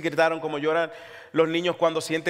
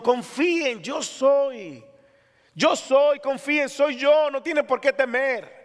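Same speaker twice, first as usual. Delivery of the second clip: high ringing preacher call; Jesus calling out to his frightened disciples; 7 semitones higher, slower than usual. Acted. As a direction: lift it as a command of comfort. Quoting Jesus, building.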